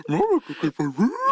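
goofy Scooby-Doo style; dog mascot voice. Retold or imitated. Cohost echoing the ad line as a cartoon dog mascot. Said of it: "it's like a dog mascot".

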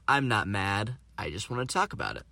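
normal talking voice

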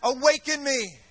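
shouting fiery sermon to the crowd; rally the congregation, loud and rousing; preaching at full force. Real, not acted. Preacher rousing his congregation, fiery shout.

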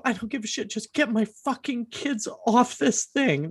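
relived desperation, high but not shouted; desperate parent's demand. Retold or imitated.

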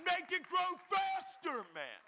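high, drawn-out desperate shout; exasperated full-voice plea, acted out; impatient shout. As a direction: yell the demand slowly, fed up. Retold desperate demand to pastor.

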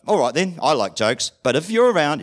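usual speaking voice

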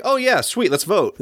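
high-pitched pretend enthusiasm; hammed-up keen agreement, starting high; mock eagerness. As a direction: agree eagerly, imitating an easily swayed crowd. Mocking the senators' eager agreement.

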